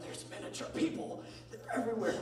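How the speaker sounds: high, hushed retold excitement; mock wonder. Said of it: "I'm like".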